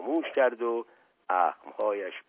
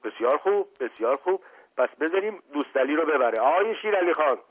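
lightly voiced sly charmer, raised toward the end; teasing prince calling out; 6 semitones higher, faster than usual. Asadollah calling to the butcher, sly and raised. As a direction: call across the room with mock innocence.

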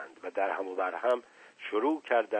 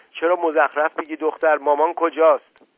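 loud, higher-pitched scolding question; irritated demand; 6 semitones higher, 8 dB louder than usual. Acted. Father questioning his runaway daughter, irritated demand. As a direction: demand an answer impatiently.

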